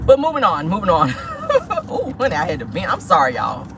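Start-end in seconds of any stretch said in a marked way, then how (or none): 1.2-1.8 a real high-pitched laugh. Real, not acted. Laughing after venting rant.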